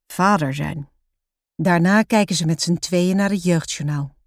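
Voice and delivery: usual speaking voice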